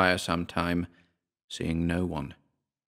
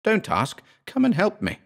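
higher, more desperate cry for help; frantic plea; 12 semitones higher than usual. Acted. Terrified narrator begs again.

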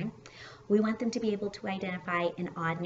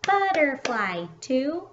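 higher, louder, slow kid imitation; mock child's confident miscount, pitched up; child counting syllables; 6 semitones higher, 8 dB louder, much slower than usual. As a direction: chant it slowly like a pupil clapping each beat. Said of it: "sometimes they'll be like".

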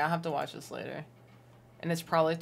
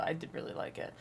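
soft unvoiced whisper; 8 dB quieter than usual. Real, not acted. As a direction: breathe it as a whisper. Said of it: "whispered repeat".

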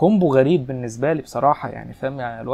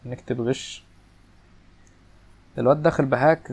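usual speaking voice

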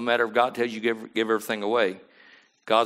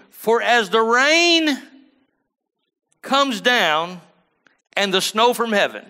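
high, loud, slow proclaiming of the verse; preaching scripture; 10 semitones higher, 6 dB louder, slower than usual. Acted. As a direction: ring the scripture out to the church.

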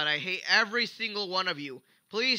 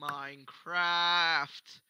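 very slow, stretched word; drawn out announcement; much slower than usual. Acted.

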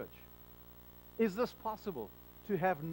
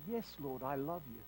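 soft, low, humbled answer to Jesus; quiet awed confession; hushed stammered reply; 8 semitones lower, 10 dB quieter than usual. Pretend. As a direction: murmur it, overwhelmed by the light.

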